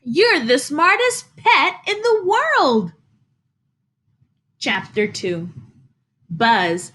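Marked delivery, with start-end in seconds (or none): 0.0-2.9 much higher, astonished and delighted; a boy who can't believe his fly knows his name; amazed boy. Acted. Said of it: "The boy was surprised".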